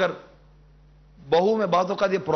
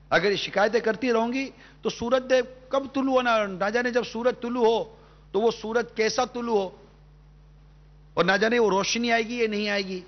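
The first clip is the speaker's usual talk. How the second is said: higher, impassioned sermon voice; fervent preaching; 6 semitones higher than usual. Acted. Preacher urging the sisters on TV, impassioned and higher. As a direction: plead with the listening sisters, voice lifted high.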